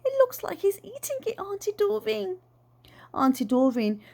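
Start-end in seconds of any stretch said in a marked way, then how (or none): 0.0-2.4 put-on high, small child's voice; little girl voice. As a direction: speak as a young girl.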